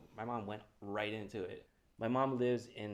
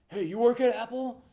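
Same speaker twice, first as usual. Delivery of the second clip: high, loud put-on old man's bark; barking old neighbour confronting him about his parked car; angry old guy; 14 semitones higher, 15 dB louder than usual. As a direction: mimic the cranky old neighbour. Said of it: "This old guy".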